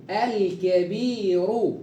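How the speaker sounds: الكبير drawn out slowly, ra thick with damma; slow heavy ra. Shown as a demonstration. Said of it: "را ضمة".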